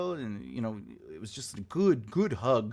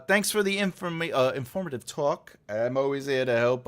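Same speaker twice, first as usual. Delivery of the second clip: ordinary talk, nothing marked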